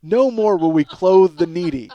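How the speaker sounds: ordinary talk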